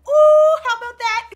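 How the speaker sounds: high, loud whoop at a burn; hyped reaction. Real, not acted. Reacting to a kid roasting a parent, loudly hyped. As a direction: crow over someone else's comeback.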